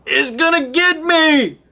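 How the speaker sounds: high, slow terrified cry; panicked yell. Retold or imitated. Game master voicing the man from the woods, panicked yell. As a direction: scream it like something's chasing you. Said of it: "And he yells".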